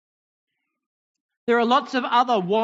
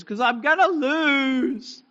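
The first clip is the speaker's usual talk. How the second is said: slow, higher, whiny mock sob of a little boy; dad imitating his son crying over losing at chess; imitating a crying child; 4 semitones higher, slower than usual. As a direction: play the tearful son, dragged out. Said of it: "What are you crying for?".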